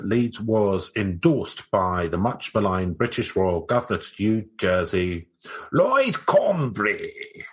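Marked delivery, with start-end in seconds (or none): 5.7-7.5 grand announcer voice. Pretend.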